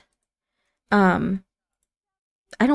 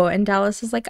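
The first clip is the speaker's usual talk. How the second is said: ordinary delivery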